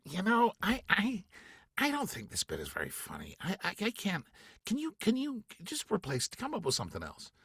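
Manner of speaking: apologetic director asking for a rewrite; lightly voiced Bill. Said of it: "then we'll say to you".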